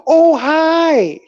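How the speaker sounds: high, loud drawn-out greeting; girlish-guy talk. Retold or imitated. Patient mimics a long-haired nurse's hello, high girlish-guy voice. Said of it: "does that type of a talk".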